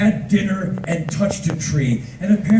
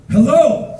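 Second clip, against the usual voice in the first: loud, high echo of a hello; hearty greeting; 8 semitones higher, 7 dB louder than usual. Retold or imitated. Tourist greets bus driver back, loud and high. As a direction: echo the greeting in a big put-on call.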